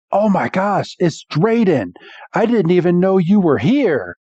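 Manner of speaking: play-acted astonishment; starstruck surprise. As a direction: act out an awed crowd spotting someone famous. Villagers recognise a famous man.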